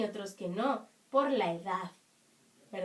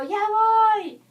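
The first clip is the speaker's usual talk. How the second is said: high, loud call to someone at home; demonstrated shout; 9 semitones higher, 11 dB louder than usual. Acted. Pupil answering mamá from afar, shouting. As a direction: holler it back across the house. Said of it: "le gritan a mamá o le gritan".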